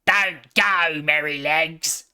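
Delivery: blunt piglet plea; grunted. A narrator's reading. Piglet grunting at his friend to stay. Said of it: "grunted Squeaker".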